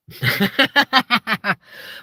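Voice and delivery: loud high burst of laughter. Real, not acted. Loud laugh at lion idea.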